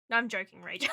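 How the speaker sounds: usual speaking voice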